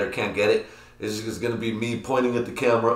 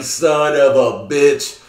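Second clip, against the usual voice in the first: slow, much louder, higher exasperated curse; drawn-out curse; 6 semitones higher, 10 dB louder, much slower than usual. Retold or imitated. A vlogger after no outro song plays, slow loud drawn-out curse. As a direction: stretch the curse out in frustration. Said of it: "I was like".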